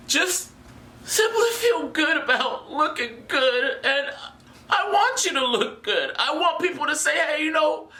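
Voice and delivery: high, choked-up plea; getting emotional. Real, not acted. Host urges the guys to look good, getting emotional. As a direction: plead as if overcome with feeling. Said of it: "I'm getting a little emotional".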